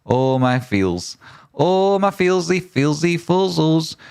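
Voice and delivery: high, drawn-out comic lament; slow, high-pitched wail of wounded feelings; mock heartbreak. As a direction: whimper it in a silly, climbing high voice. Mock heartbreak reading a chat message.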